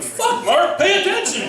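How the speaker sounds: high, loud scolding himself; front of brain yelling. Retold or imitated. His brain yelling at him mid-sex, high and loud. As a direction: snap at yourself to focus. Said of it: "Front of my brain's going".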